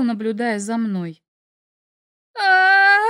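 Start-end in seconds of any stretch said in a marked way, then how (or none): 2.4-3.0 loud startled scream; terrified scream. A narrator's reading. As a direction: scream in fright at full volume. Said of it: "заорала я, что есть мочи".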